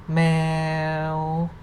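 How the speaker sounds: "Meow" drawn out on a level pitch; mid tone. Shown as a demonstration. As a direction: hold "Meow" long and even. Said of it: "no tone mark represent the mid tone".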